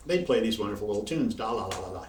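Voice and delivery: usual speaking voice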